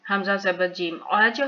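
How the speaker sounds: आज with its final j echoed; qalqala bounce. Shown as a demonstration. Teacher spelling for learners, आज ending in a bounced j. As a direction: release the j of आज with a quick rebound. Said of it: "फिर कलकला".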